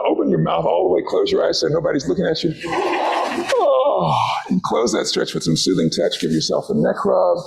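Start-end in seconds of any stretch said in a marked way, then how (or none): 2.7-4.1 big audible yawn. Shown as a demonstration. Leading the group yawn, showing a big yawn. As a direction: let a real yawn take over the word. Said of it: "And you can yawn".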